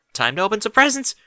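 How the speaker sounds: fast, high and loud; excited announcement. A narrator's reading. Derpy announcing presents to the foals, fast excited call. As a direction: burst out with the news.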